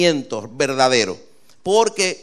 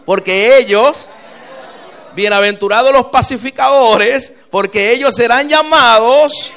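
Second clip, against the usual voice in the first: reads each line louder and louder, leaving it open; building call and response; 4 semitones higher, 6 dB louder than usual. Shown as a demonstration. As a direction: pastor leads the congregation, building to a loud call. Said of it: "Vamos a leer rápidamente".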